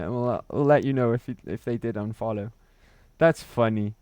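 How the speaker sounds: usual speaking voice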